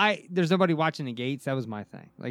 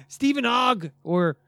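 slow announcing; 5 semitones higher, 4 dB louder, slower than usual. Retold or imitated. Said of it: "I'm gonna be like".

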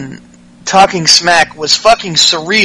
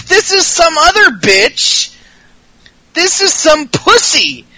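much higher, stunned disbelief; incredulous; 8 semitones higher than usual. Retold or imitated. Host retelling his reaction to humble Alexa Bliss, shocked disbelief. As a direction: gasp it out in disbelief, slowing. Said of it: "I was like".

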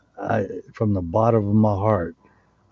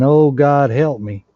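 raised, louder cry for help; crying out to God; 4 semitones higher, 5 dB louder than usual. Retold or imitated. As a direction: call out to heaven in a plea. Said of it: "we're sitting there saying".

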